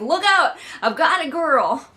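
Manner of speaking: high, loud hammed-up warning; mock heroic yell. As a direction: call out like a charging rescuer, hammed up. Imagined hero smashing through doors.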